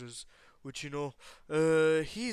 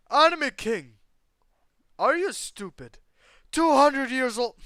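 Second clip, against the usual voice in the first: yelling at chat; 10 semitones higher, 14 dB louder than usual. Real, not acted. Angry outburst at chat about age.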